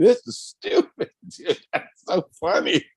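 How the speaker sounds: high, slow, laughing through the words; cracking up at something dumb; giggling disbelief. Retold or imitated. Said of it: "I was dying laughing".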